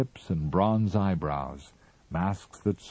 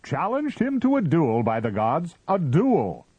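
high and loud; wildly triumphant; 10 semitones higher, 5 dB louder than usual. A narrator's reading. Mister Ah Ah crows in triumph from the window, high. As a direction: crow it out in triumph. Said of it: "wildly triumphant".

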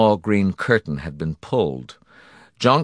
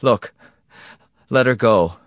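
higher, louder, mildly panicked; babbling plea; 5 semitones higher, 7 dB louder than usual. A narrator's reading. Husband begging for his wife's release, babbling. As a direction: plead in a fluster. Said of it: "Morrison babbled".

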